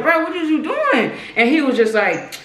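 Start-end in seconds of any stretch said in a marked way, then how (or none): 0.0-1.1 mock shock. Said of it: "I was just like".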